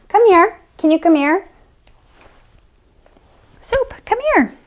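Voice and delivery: high, loud call across the room; calling the dog. Real, not acted. Owner calling her dog Soup to come, loud high call.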